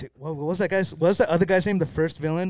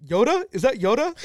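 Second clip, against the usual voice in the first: high, loud, puzzled guess; raised, incredulous questioning; everyone guessing aloud; 10 semitones higher, 9 dB louder than usual. Retold or imitated. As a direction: imitate people calling out a baffled guess. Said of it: "everyone was like".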